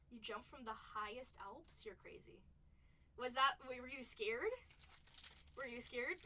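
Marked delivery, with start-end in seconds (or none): 0.1-2.4 hushed whisper, no voice; whispered disbelief at a viewer's jump. Real, not acted. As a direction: breathe it out like a shocked aside. Reacting to chat about paragliding.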